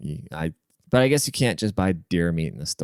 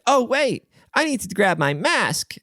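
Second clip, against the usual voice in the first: high mock kid voice; little boy; 13 semitones higher, slower than usual. Father at restaurant, his son speaks, high mock kid voice. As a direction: play a small boy, high and eager. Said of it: "the little boy's like".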